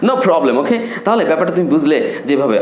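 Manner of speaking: ordinary delivery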